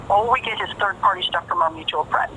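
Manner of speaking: ordinary delivery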